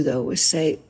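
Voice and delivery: usual speaking voice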